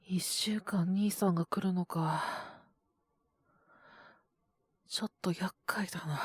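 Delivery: whispered, talking to himself; muttered to himself. Acted. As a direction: grumble under the breath. Alone after call, muttering.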